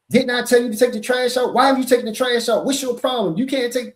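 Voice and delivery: high, loud nagging at a partner; harsh scolding. Retold or imitated. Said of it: "As a woman, you might say".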